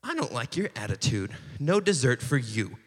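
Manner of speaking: low, soft mock parental scolding; quietly stern, imitated parent laying down punishment; grumpy parent. God as parent answering complainers, low mock grumpy scolding. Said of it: "like, the grumpy parent".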